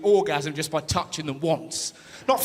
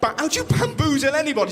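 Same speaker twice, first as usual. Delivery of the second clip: ordinary talk, nothing marked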